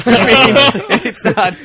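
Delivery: laughing through the words; can't stop laughing mid-sentence. Real, not acted. Laughing at his own story.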